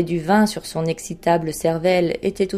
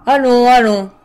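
loud, high impatient urging; 5 semitones higher, 10 dB louder than usual. A narrator's reading. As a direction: hurry him along sharply, voice raised. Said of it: "dit le roi impatienté". (roi impatienté, voix forte et haute)

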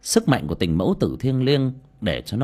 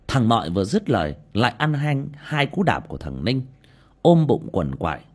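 plain reading voice

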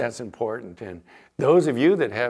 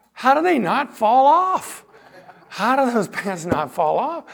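incredulous; 12 semitones higher, 6 dB louder than usual. Real, not acted. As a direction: stretch it out in amazed disbelief. Pastor marvels at sagging pants.

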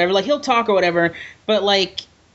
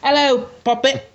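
higher put-on Cockney greeting; 5 semitones higher than usual. Podcaster demonstrates what he says, mock Cockney voice. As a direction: imitate a Cockney catchphrase. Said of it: "That's what he says".

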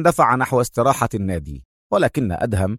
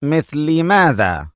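slow, drawn-out challenge; defiant; 3 semitones higher, slower than usual. A narrator's reading. Rival daring Adham to act, defiant. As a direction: dare him, stretching the question. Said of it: "قال لانسلوت متحديا".

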